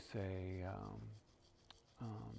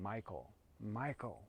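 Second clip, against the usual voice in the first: slow, firm calling of a name; a hint of a mother's firm voice calling her son; emphatic feminine call; slower than usual. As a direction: call him like a spirit mother. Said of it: "very emphatically".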